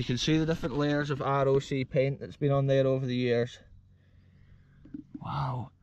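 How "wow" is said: ordinary talk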